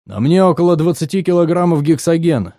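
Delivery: plain reading voice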